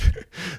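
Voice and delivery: high, loud short laugh; burst of laughter. Real, not acted. Host laughing at Michael Irvin's Facebook idea, laughing.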